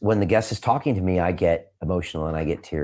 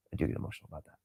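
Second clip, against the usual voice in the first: emotional near whisper; 5 semitones lower, 15 dB quieter, much faster than usual. Real, not acted. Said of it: "the tough guy part of me just made me stop crying".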